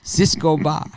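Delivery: put-on loud Spanish-accented vendor call. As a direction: hawk it in a heavy Spanish accent. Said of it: "but with a heavy Spanish accent".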